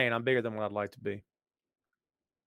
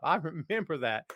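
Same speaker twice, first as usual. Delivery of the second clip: usual speaking voice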